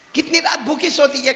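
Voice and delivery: mother pressing the point with a rising cry; tearful, rising mother. Retold or imitated. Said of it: "ماں نے روتے روتے کہا".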